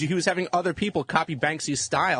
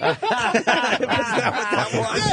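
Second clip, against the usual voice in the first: laughing; 7 semitones higher, 3 dB louder, slower than usual. Real, not acted. Cracking up at co-host's story.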